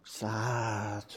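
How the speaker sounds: low, soft and sad. Shown as a demonstration. About a boy sick in bed, quiet and sad. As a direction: drop it quiet and downcast. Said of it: "he's sick in bed".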